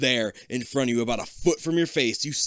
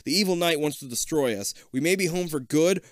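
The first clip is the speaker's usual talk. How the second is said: warning; 4 semitones higher, 5 dB louder than usual. Acted. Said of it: "Kip warns".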